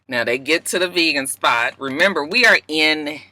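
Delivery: ordinary delivery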